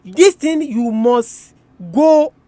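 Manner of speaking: usual speaking voice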